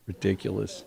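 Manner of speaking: usual speaking voice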